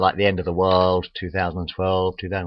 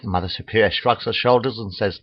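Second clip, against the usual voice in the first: normal talking voice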